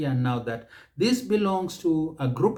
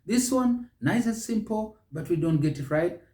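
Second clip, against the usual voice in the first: ordinary talk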